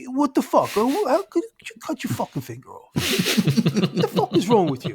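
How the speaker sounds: a Long Island douche scolding his friend. Retold or imitated. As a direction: imitate a Long Island guy yelling at a friend. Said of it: "He's like a, like a Long Island douche".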